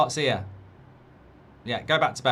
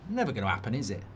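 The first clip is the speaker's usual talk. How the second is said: ordinary delivery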